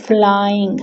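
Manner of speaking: "flying" drawn out slowly; syllable demo. Shown as a demonstration. Teacher reading out a word to find its syllables, syllable demo. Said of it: "word को loud read out करना होगा".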